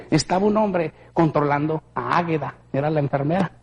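ordinary talk

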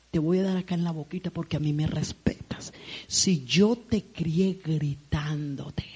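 whispered, slow warning; low whispered scolding; stern whisper. Retold or imitated. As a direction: hiss a threat to the daughter.

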